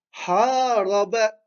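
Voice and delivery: "Haraba" with long "Ha", short "raba"; lengthened first vowel. Shown as a demonstration. Teacher reading the similar next word, "Haraba" with long "Ha". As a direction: slowly stretch "Ha", then finish "Haraba" short. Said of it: "c'est la même chose".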